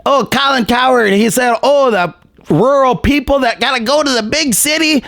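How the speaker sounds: high, sneering elitist voice; high mocking coastal-elite voice; mocking Cowherd. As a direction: sneer down at rural folks. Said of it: "It's like".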